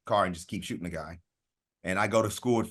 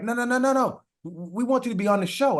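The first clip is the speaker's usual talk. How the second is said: high, frantic interviewer voice; casting lady pleading in a high put-on voice; imitating the interviewer; 14 semitones higher than usual. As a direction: squeak it out, a panicked lady calling him back. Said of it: "She was like".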